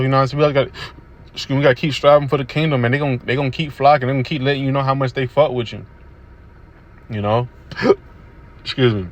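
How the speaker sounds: ordinary delivery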